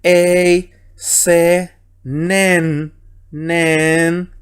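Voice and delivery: "ei, se, nen, nen" one held syllable at a time; deliberate, evenly held syllables "ei, se, nen, nen" for learners; pronunciation demonstration. Shown as a demonstration. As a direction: sound out "ei, se, nen" separately, lingering on the final n of "nen". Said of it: "Así que aquí sería".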